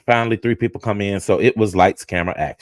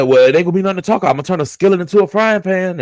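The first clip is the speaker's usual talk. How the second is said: fast threat; 8 semitones higher, faster than usual. Retold or imitated. Husband threatening, rapid retort.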